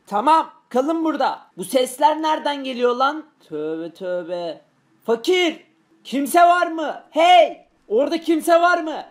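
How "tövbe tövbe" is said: much lower, softer mutter; low muttered aside. Acted. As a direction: mutter it under your breath, unnerved. Hearing strange noises upstairs. (alçak sesle mırıldanma)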